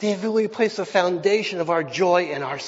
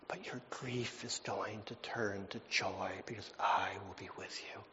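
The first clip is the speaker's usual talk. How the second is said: whispered, much softer promise; hushed whisper; 7 semitones lower, 16 dB quieter than usual. Acted. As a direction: breathe it out like a secret comfort. Tender closing promise, hushed.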